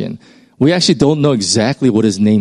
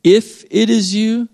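doubting Peter; 8 semitones higher, slower than usual. Retold or imitated. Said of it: "it says".

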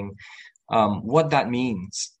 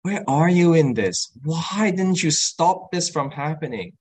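anguished plea; 5 semitones higher than usual. Retold or imitated. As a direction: call out to God in pain.

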